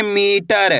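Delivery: Metre with a long, stretched मी; drawn-out reading. Shown as a demonstration. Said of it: "खींच के पढ़ेंगे".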